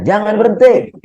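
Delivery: high mock cry of stop; mock shout. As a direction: play the wife yelling at her husband, half in jest. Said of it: "Saya teriak aja dah".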